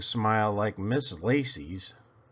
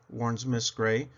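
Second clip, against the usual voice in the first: ordinary delivery, nothing marked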